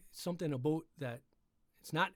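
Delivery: ordinary delivery, nothing marked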